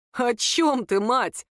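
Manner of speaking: usual speaking voice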